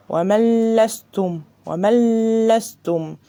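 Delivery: ومن لستم slow, noon merged into a doubled lam; slow Quran reading of ومن لستم; tajweed recitation. Shown as a demonstration. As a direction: chant ومن لستم slowly, no nasal hum. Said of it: "أخفيت النون وما اديتهاش غنة".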